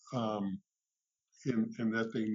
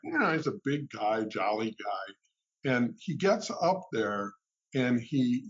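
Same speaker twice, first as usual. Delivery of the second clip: ordinary delivery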